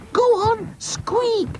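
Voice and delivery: very high, slow squealed order; shouting boy. Acted. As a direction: goad it in a squeaky child's shout. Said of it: "shouted Oz".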